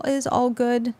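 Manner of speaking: ordinary delivery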